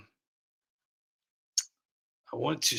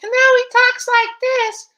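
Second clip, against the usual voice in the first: extremely high, loud, slow caricature; sky-high loud cartoon politician voice; Adam Schiff; 9 dB louder, slower than usual. Shown as a demonstration. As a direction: squeal it slowly at the very top of the voice. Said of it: "actually really changed over the years is Adam Schiff".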